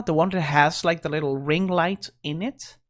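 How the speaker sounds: ordinary delivery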